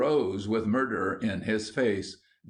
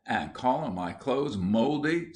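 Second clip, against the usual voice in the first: usual speaking voice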